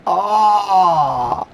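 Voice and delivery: slow, loud and high with awe; drawn-out amazed cry at the new dinosaur; thrilled gasp. Real, not acted. New dinosaur unlocked, awed.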